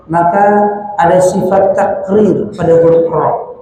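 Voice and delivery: ordinary delivery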